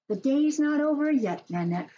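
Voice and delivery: high, bright mom voice reading aloud; Mom's voice. Acted. Mom voiced in read story.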